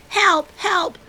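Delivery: high, loud cry for help; cried for help. Acted. Cub calls for help as animals take leaves, high and loud. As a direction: call out in alarm. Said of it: "cried Fletcher".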